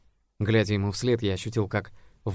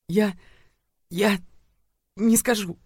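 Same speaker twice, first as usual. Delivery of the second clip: put-on frightened girl; 11 semitones higher, 5 dB louder, slower than usual. A narrator's reading. Terrified girl refusing.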